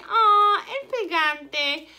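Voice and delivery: high, loud, slow "Aw, el pegante" in mock pity. Shown as a demonstration. Teacher pities the word nobody remembers, hammed-up sympathy. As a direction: coo over the forgotten word like it's a sad puppy. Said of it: "I always feel bad for this one".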